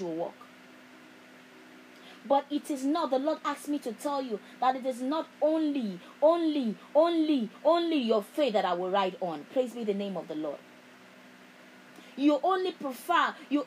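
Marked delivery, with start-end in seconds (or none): none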